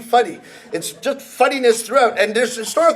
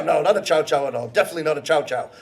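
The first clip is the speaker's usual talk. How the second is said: brusque denier; 7 semitones lower, 5 dB quieter, faster than usual. Acted. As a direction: lower and faster, flatly denying. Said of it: "a conversation like this".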